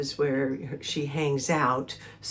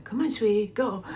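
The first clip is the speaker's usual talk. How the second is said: higher pet-talk nudge; higher sing-song coaxing to a kitty; coaxing the cat; 6 semitones higher than usual. Retold or imitated. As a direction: sweet-talk the cat one more step.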